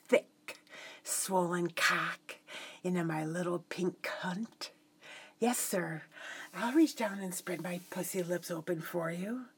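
sultry whisper. Acted. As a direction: whisper it seductively.

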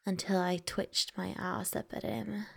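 ordinary delivery

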